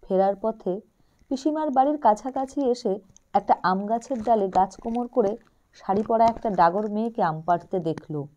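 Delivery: plain reading voice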